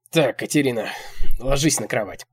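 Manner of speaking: put-on loud, firm old man's order; commanding. A narrator's reading. Old man orders Katerina about, commanding. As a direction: order her onto her feet or down, no argument. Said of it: "командует он".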